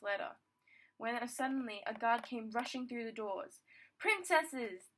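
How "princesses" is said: loud, higher-pitched guard's yell. A narrator's reading. Guard rushing in to alert the princesses, yelling. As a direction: call out urgently to the princesses. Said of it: "the guard yelled".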